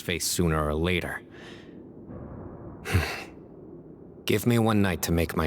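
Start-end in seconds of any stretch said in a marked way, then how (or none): 2.1-3.2 long heavy sigh out through the nose; weary sigh. Acted. Cyno facing the trade for his professor, a heavy sigh. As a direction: let the weight of the decision out in a breath. Weighing a hard choice.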